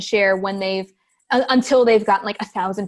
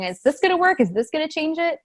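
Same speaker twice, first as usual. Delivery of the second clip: anxious self-doubt; 7 semitones higher than usual. Retold or imitated. Listener imagined second-guessing herself.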